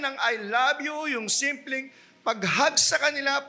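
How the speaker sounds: high, impassioned sermon delivery; full-force preaching. Acted. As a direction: preach it hard to the congregation.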